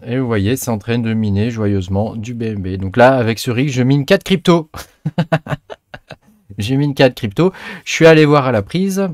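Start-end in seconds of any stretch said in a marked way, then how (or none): 5.0-6.1 short amused laugh. Real, not acted. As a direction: burst out in a brief chuckle. Amusé par ses quatre cryptos. (petit rire amusé)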